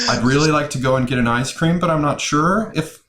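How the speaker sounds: a lightly played unsure youngster; mock millennial uptalk. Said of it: "I was trying to see if I could do it".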